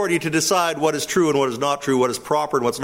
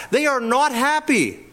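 higher, rising sermon intensity; fervent preaching; 7 semitones higher than usual. Acted. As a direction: drive the point home from the pulpit.

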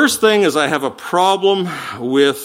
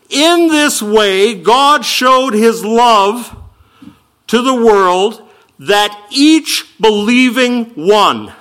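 "In this way" drawn out, loud and higher; slow emphatic reading; 4 semitones higher, 3 dB louder, much slower than usual. Shown as a demonstration. Preacher rereading the verse slowly to show its true stress. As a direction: read it slow, landing on "each believing one". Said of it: "There's your emphasis".

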